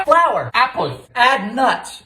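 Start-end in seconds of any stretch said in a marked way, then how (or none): none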